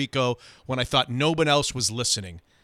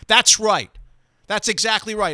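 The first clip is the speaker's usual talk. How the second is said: angry retort; 7 semitones higher, 4 dB louder than usual. Retold or imitated. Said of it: "angry quote of Digger".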